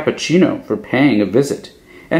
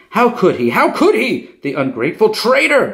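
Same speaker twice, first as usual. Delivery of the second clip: fuming; 10 semitones higher than usual. A narrator's reading. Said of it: "Bambolona fu-, fumed".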